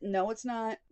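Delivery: ordinary talk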